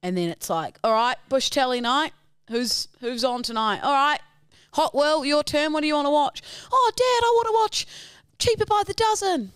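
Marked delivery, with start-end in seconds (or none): 0.8-6.4 lightly voiced dad, raised and higher; dad rallying the kids round the telly; dad hosting TV night. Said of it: "So your dad's like". 6.7-9.5 put-on very high, loud child voice; excited kid begging Dad for a film; pleading kid. Acting child answering dad's question.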